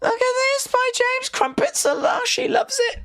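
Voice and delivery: very high falsetto squeal; squealed mock delight. Streamer teasing James over lush crumpets, squealed mock delight.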